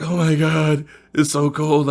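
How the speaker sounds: high startled cry; shocked by cold. Retold or imitated. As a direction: blurt it out, jolted awake. Waking in cold bath water.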